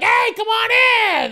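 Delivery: high, loud come on in; welcoming call. Retold or imitated. Host in the garage, loud welcome. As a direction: call her in cheerfully. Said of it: "I'm just like".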